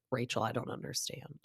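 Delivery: quiet, unsympathetic deadpan; Jen Fessler. Retold or imitated. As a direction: imitate someone softly refusing to sympathize. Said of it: "she's also not being sympathetic".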